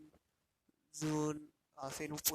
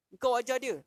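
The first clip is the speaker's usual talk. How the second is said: angry accusation; 6 semitones higher, 6 dB louder than usual. Retold or imitated. King confronts the monk.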